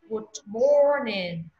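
slow "Good morning", MOR held long, falling NING; stretched "morning" for learners to copy; model pronunciation. Shown as a demonstration. Teacher drilling an office greeting, slow long "morning". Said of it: "Mai lên công ty nói good morning".

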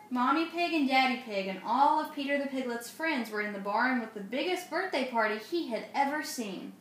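plain reading voice

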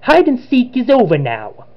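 put-on high, amateur Italian accent; bad Italian accent, starting high and falling away; Luigi. Villain bids Richard farewell in a cutscene, put-on Italian accent. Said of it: "I did a bad Luigi voice".

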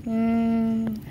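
normal talking voice

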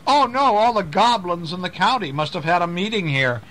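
panicked schoolmaster yelling about goblins; cried out in fright. Acted. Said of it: "he cried out".

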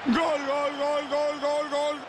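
rhythmic drawn-out goal call; goal shout. Acted. Goal call in match commentary.

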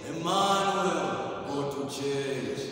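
slow, sung, booming movie God; a preacher mocking the Hollywood God. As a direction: ham up a film's voice of God, slowly. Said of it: "That's not how God speaks".

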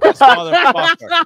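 high, loud, disbelieving outburst; exasperated outburst. Retold or imitated. Reading a late-night text from an old teammate, high loud mock outrage.

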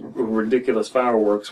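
normal talking voice

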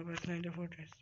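normal talking voice

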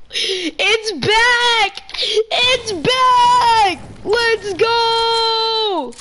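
high, loud, near-crying shouts of joy; overjoyed cheering. Real, not acted. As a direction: celebrate like your team just won. Cheering the drum gun's return.

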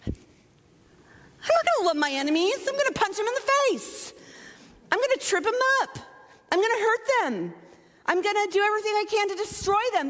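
defiant worldly retort. Pretend. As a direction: play the petty self who refuses to forgive. Worldly retort to loving enemies.

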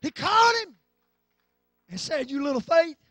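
high, loud, drawn-out sermon cries; full-force preaching. Real, not acted. Preacher telling how Jesus caught sinking Peter, full-force preaching. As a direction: shout it in slow, punched phrases.

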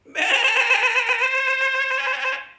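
high, loud wavering sheep baa; sheep bleat. Shown as a demonstration. Host in sheep competition with mommies, loud quavering bleat. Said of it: "I'm gonna make a sheepy voice".

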